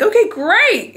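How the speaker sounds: big, high, bouncy mascot "great"; Tony the Tiger voice. Retold or imitated. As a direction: boom it out like a cheery cereal-box tiger. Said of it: "in his Tony the Tiger voice".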